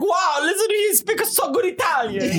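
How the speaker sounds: put-on high, shouted Italian accent; delighted Italian. Italian amazed at a foreigner's ciao, shouting excitedly in an Italian accent. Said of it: "you say ciao to an Italian".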